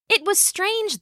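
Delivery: plain reading voice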